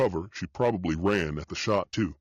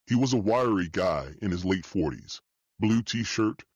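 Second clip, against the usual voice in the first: ordinary delivery, nothing marked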